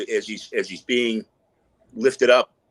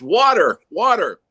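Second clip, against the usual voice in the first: high, loud cracked cry for water; hammed-up gasping plea; 9 semitones higher, 7 dB louder, slower than usual. Crawler back from the inn begging the salesman, high loud plea. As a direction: beg like a man dying of thirst.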